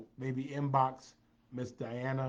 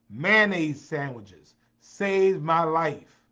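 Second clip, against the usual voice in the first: slow, much louder proclamation; loud emphatic declaration; 3 semitones higher, 11 dB louder, much slower than usual. Real, not acted. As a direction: belt out each word for emphasis. Emphatic declaration about cheap food.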